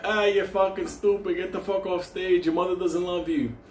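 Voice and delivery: high, mocking heckle from the audience; heckling kid. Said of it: "and I was like".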